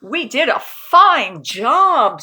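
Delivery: slow, loud proud declaration; proud satisfied. Acted. Uncle Murray proud of the clean house, slow and loud. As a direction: announce it with pride.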